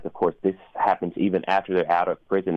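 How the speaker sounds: ordinary talk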